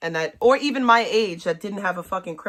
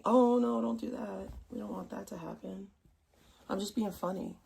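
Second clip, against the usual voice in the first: much softer, hushed mock comfort; mock hushing; 8 dB quieter than usual. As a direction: hush them like a nervous child. Mock hushing worried viewers.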